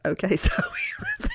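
breathy laugh through the words. Real, not acted. Mom laughs starting the twins' tattling story, real giggle.

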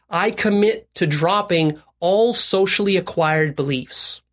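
very slow, phrase-by-phrase pledge; deliberate recitation. Shown as a demonstration. Coach modelling a commitment for listeners, deliberate recitation.

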